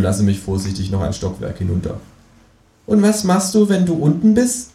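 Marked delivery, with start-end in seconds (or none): 2.9-4.7 much higher, louder cartoon kangaroo; a high, cheeky kangaroo asking. Kangaroo asks the narrator abseiling from the balcony, high and cheeky. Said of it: "Känguru asks".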